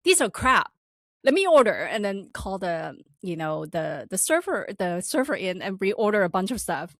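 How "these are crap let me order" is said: loud, higher scornful brush-off; mock boss sneering at the food; dismissive boss. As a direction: scoff at the order like an arrogant boss. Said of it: "Mister Kong looked at it, like".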